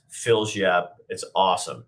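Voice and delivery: ordinary delivery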